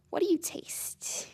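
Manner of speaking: slow, intimate whisper; soft, creepy slow whisper; whispered question. Acted. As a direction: whisper it close, drawing it out. Villain's intimate menacing question.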